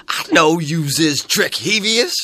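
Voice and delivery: lightly mock her garbled accusation, slowly; imitated woman. The woman accusing him of mischief, slow and mangled. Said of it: "she goes".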